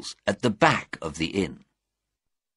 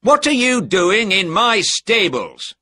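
loud, high-pitched challenge; angry cry; 11 semitones higher, 6 dB louder than usual. A narrator's reading. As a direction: confront an intruder indignantly. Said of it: "he cried".

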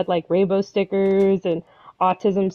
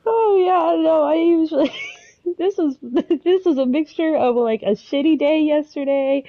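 teary laugh; 5 semitones higher than usual. Real, not acted. Crying, overwhelmed by kind chat.

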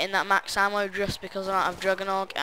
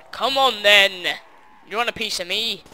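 taunting challenge; 11 dB louder than usual. Pretend. Taunting zombies.

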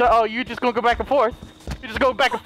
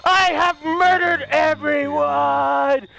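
triumphant shout; 3 semitones higher, slower than usual. Acted. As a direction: gloat over the win. Triumphant after all kills.

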